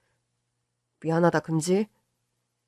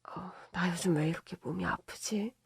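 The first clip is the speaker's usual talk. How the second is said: almost whispered, breathy worry; hushed murmur; 11 dB quieter than usual. Acted. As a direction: say it under your breath to your husband. Wife quietly mentions her aches.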